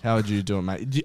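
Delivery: ordinary talk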